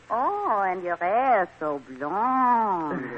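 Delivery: slow, admiring fake French accent; phony French accent. French guest admires a man's hair, phony French accent. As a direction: draw it out in a put-on French accent. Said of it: "My phony French accent".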